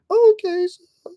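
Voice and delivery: high put-on voice of a car boot seller; mock seller. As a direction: play the meek stallholder. Role-plays car boot seller.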